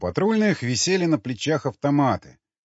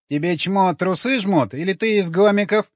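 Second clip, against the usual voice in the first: higher-pitched, snorting contempt; scornful snort; 7 semitones higher, 4 dB louder than usual. A narrator's reading. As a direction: sneer it in a raised pitch. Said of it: "фыркнула она". (презрительно, фыркая)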